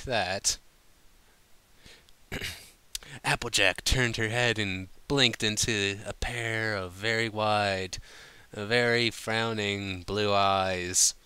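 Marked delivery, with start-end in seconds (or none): none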